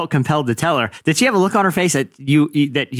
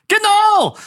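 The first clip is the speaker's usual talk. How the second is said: high-pitched golf heckler holler; mock scream; 3 dB louder than usual. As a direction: holler it like an overeager golf spectator. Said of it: "it's the first guy to scream".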